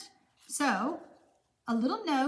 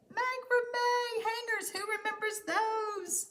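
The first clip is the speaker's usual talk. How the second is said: delighted nostalgia; 8 semitones higher, 4 dB quieter, slower than usual. Real, not acted. Nostalgic about seventies macrame.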